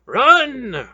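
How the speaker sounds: very high, loud yell; shout. Acted. Relg shouting at the group to run, loud. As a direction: scream the order. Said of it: "Relg shouted again".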